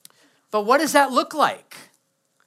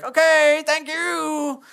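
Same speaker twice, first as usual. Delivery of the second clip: awkward reply; 6 semitones higher, much slower than usual. Retold or imitated. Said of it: "pulling the awkward face like".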